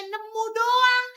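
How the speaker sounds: high, slow, stretched-out sneer; sneering drawl. Acted. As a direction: drag out the words to belittle her. Bully sneers at rival. (nada mengejek yang dipanjangkan dan tinggi)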